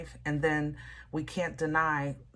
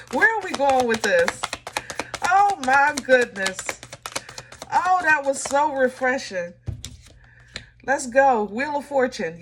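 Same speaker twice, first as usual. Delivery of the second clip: thrilled; 7 semitones higher, 6 dB louder than usual. Real, not acted. Thrilled after the card reading.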